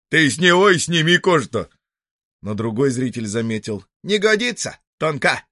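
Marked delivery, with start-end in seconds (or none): 0.1-1.7 громко, выше, издевательски; крикни злую шутку; насмешка из толпы. Acted. 4.0-5.5 очень высоко, насмешливо; подхвати шутку писком; насмешливый зритель. Acted.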